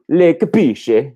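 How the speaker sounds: Le capisce? slow, with a big high rise on capisce; hammed-up Italian question. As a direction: ham up the Italian question lilt. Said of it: "Am I becoming more Italian?".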